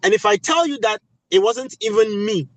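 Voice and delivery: normal talking voice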